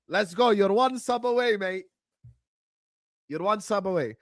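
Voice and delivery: usual speaking voice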